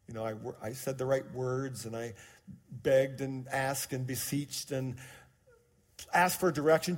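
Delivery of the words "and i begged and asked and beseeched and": normal talking voice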